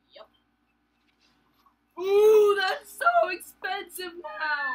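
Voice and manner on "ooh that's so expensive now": loud, high, slow dismayed cry; shocked wail. Real, not acted. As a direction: wail at the price. Reacting to game prices.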